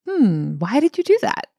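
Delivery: high, musing "hmm" then a wondering question rising at the end; curious tone. Shown as a demonstration. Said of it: "shifting from judgment to curiosity".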